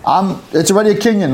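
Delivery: high, indignant retort; mock angry refusal. Retelling the man refusing his money, mock indignant. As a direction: play the man who insists it's his. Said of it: "He was mad".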